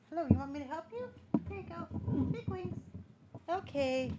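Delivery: soft high coo. Real, not acted. Helping her cockatiel down, soft high cooing. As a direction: coo gently while helping him.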